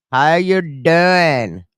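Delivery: very slow stretched "How you doing?" with each word pulled long; drawn-out playful greeting. Acted. Vlogger greeting a viewer called Maniac, in a slow teasing drawl. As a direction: drag out "How you doing?" like a cheesy pickup line.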